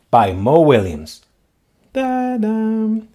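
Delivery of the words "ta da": plain reading voice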